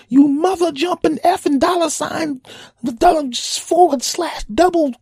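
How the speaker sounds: high, loud, squealing mock cursing tirade; cursing rant. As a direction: rattle off censored curses in a shrill fury. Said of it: "He cursed a lot".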